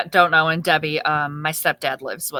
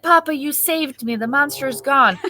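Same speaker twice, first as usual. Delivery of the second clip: put-on high-pitched grateful little boy; relieved child; 7 semitones higher than usual. Son thanking Papa for saving him, relieved child voice. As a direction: imitate a kid thanking his hero.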